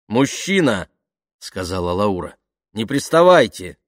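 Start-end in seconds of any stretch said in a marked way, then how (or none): 0.1-0.9 raised, loud indignant address; stern rebuff. Acted. Woman rebuffs a pushy stranger. 2.7-3.8 loud, high, firm warning; indignant rebuff. Acted. Woman rebuffs a pushy stranger.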